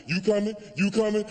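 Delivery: high, insistent summons to her friends; imitated woman storming out; departing angry woman. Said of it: "She like".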